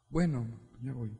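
put-on a teen who sighs and obeys; resigned, meek reply. Teen obeying an angry parent, meek and quiet.

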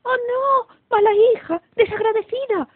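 high, loud outraged queen; shrill, dismayed mother's cry; scolding shout. A narrator's reading. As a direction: wail and scold an ungrateful daughter. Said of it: "gritó la reina".

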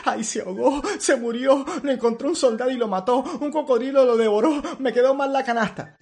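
a mother spiralling into panic; panicked rambling. Acted. Mother imagines her baby dead, panicked high rambling. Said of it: "empieza a decir mil cosas".

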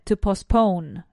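"to postpone" slow, with a nearly silent T; barely touch the T in "to postpone"; soft T. Shown as a demonstration. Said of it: "the T sound is there ... kind of silent".

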